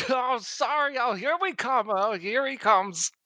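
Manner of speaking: a hint of nervous goblin. Player voicing his goblin as the guard arrives, lightly high.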